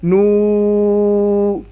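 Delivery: نو held long as noooo. Shown as a demonstration. Teacher demonstrates the long oo. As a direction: stretch the oo in نو. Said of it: "نون پیش وا".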